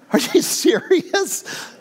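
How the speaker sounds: high, slow, incredulous; stunned disbelief. Retold or imitated. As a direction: ask it as if you can't believe your ears. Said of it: "I was like".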